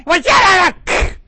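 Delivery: high, loud, slow shut up; mock shout. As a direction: snap it at the family, drawn out. Family fighting arriving at church.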